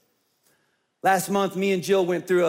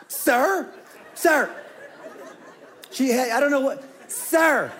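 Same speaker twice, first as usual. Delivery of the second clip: calling out; 7 semitones higher, slower than usual. Retold or imitated. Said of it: "she's like".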